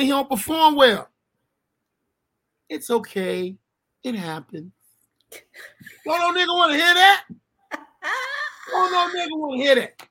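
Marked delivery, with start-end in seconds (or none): 2.7-4.7 soft, lightly voiced girlfriend reassuring; a touch of mock pity; consoling girlfriend. Girlfriend consoling after bad show. 6.0-10.1 higher, louder exasperated question; indignant outburst. Real, not acted. Outraged rhetorical question.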